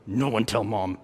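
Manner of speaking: low, soft warning; conspiratorial. Retold or imitated. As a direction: swear them to secrecy. Brother swearing siblings to secrecy.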